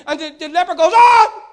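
a loud high shocked cry; leper's cry. Retold or imitated. Leper healed and crying out, loud shocked yelp. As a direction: let out a sudden pained yelp.

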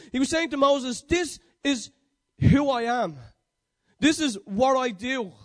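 loud, rising pitch on each need, firm answer; emphatic preaching. Acted. Preacher voices God promising Moses whatever he needs, emphatic preaching. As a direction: preach it out forcefully.

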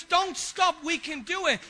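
rally your mates, we can do it; rallying cheer. Retold or imitated. Voicing an encouraging teammate.

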